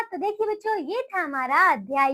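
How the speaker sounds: ordinary delivery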